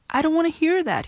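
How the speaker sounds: high loud protest; shouting. Acted. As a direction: cover your ears and shout it. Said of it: "he shouted".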